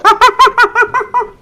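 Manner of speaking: loud high monkey call; gorilla hoot. Acted. Gorilla suit worker performing for zoo kids, loud ape hoot. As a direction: hoot like an ape after the banana. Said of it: "and again go".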